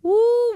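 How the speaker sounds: sudden high whoop; a preacher's joyful high whoop; woo shout. Real, not acted. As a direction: let out a loud high woo. Preacher's exclamation.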